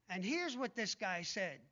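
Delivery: normal talking voice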